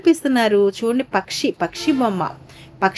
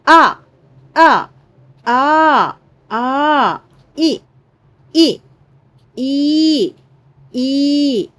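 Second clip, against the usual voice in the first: each letter called out higher and louder; classroom recitation; 4 semitones higher, 4 dB louder, much slower than usual. Acted. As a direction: chant the letters for the class to repeat. Teacher recites letters for repetition.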